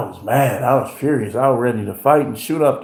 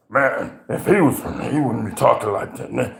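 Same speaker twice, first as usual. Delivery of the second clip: lower, softer scoffing; lower, dismissive skeptic; 6 semitones lower, 5 dB quieter than usual. Retold or imitated. Fake Christians doubting the preacher, low and dismissive. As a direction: scoff quietly.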